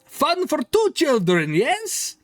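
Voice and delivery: high, broken-English shopkeeper voice; eccentric toymaker. Acted. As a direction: greet the customer with a high, odd accent. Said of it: "and says".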